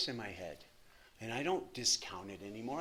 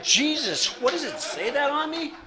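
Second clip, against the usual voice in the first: mock exasperation; 13 semitones higher, 10 dB louder than usual. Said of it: "I'm like".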